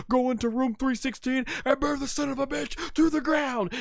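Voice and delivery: hammed-up wrestler's comeback rant; Stone Cold. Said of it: "And Stone Cold says".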